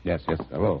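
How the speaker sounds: ordinary talk, nothing marked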